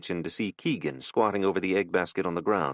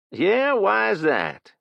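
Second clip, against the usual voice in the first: slow, much higher sneering question; mocking kid; 13 semitones higher, 6 dB louder, much slower than usual. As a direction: dare him to answer. Kid mocks Jason.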